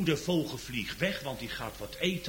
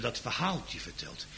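normal talking voice

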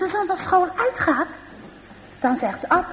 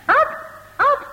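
calling out; 11 semitones higher than usual. Acted. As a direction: shout his name after him. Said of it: "en die roept".

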